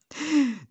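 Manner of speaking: loud high laugh; real giggle fit; burst of laughter. Real, not acted. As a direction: crack up at your own imitation. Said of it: "Ne rigolez pas".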